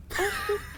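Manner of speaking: put-on very high little old-lady giggle; high granny giggle. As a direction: titter like a sweet grandma. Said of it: "grandma voice".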